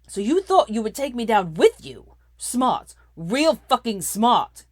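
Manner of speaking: loud, slow sneering scorn; mock her loudly; sneered. A narrator's reading. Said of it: "he sneered".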